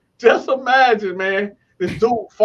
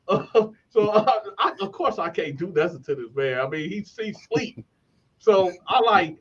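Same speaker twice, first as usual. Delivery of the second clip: ordinary talk, nothing marked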